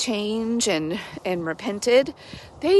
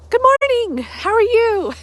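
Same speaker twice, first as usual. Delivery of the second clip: high, louder bright hello; cheery greeting; 14 semitones higher, 5 dB louder than usual. Real, not acted. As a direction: greet the viewers with a lift.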